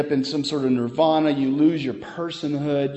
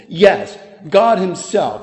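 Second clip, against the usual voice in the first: "God Himself" slow and louder, GOD hit hard; slower, louder "God Himself", weight on GOD; emphatic; 6 dB louder, slower than usual. Shown as a demonstration. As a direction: land "Yes, God Himself" with full force. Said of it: "The words are emphatic".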